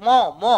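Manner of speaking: "ম" said alone as a bare consonant sound; isolated first sound. Shown as a demonstration. Teacher isolating the first sound of member, "ম" pronounced alone. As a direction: sound out "ম" by itself, twice. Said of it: "ময়ের উচ্চারণ আসছে".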